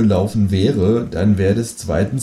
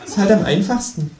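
high-pitched needling creature voice; Känguru; 11 semitones higher than usual. A narrator's reading. Kangaroo needles writer about easy storytelling, high kangaroo voice. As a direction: tease lightly, pitched well up. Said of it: "sagt das Känguru".

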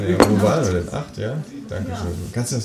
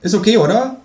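much higher, cheeky kangaroo voice; 12 semitones higher, 3 dB louder than usual. Acted. Kangaroo suggests the cinema, high cheeky voice. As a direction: squeak it out as the kangaroo. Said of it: "danach gesagt".

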